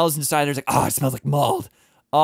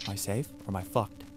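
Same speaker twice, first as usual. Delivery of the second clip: normal talking voice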